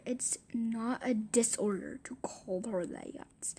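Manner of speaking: breathy hushed whisper to the camera; soft whispered remark; whispered aside. Real, not acted. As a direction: drop to a whisper as if sharing a secret.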